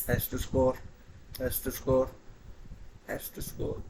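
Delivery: willing him to score, fading to a hush; anxious sports fan. Real, not acted. Nervous repeat before the kick.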